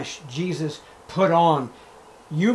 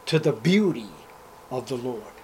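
normal talking voice

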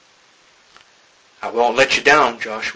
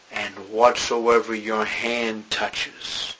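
slow dramatic close; 3 semitones lower, 4 dB quieter, much slower than usual. Acted. Slow emphatic verse close.

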